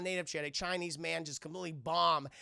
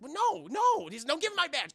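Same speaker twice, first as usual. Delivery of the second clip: much higher, louder mock whine; Chris Tucker; 9 semitones higher, 6 dB louder than usual. Chris Tucker wanting his badge back, higher mock whine. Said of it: "He's like".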